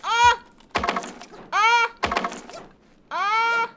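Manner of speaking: repeated high, loud screams like a siren; alarmed screams. Real, not acted. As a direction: shriek over and over like an alarm. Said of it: "It's like an alarm".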